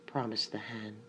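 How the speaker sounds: ordinary delivery, nothing marked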